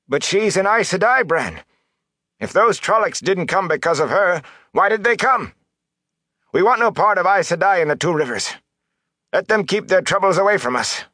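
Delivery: high-pitched, agitated plea; worked-up, desperate protest; urgent pleading. A narrator's reading. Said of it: "he went on in a pleading tone".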